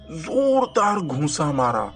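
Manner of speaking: ordinary delivery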